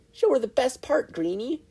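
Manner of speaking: high, loud bossy order; commanding. A narrator's reading. Mei ordering Izuku to show off, commanding. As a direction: boss him into showing off. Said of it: "Mei commanded".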